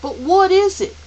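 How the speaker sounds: high, loud, insistent question; forceful boy's question; demanding. Acted. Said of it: "Calvin demanded".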